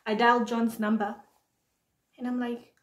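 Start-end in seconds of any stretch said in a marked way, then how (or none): none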